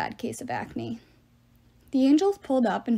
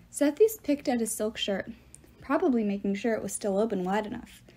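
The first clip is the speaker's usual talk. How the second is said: plain reading voice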